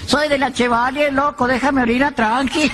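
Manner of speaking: high, slow call from the dark; a hammed-up high yell from the roadside; shouted reply. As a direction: holler back, annoyed, pitched high. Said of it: "le gritan de donde venían las luces".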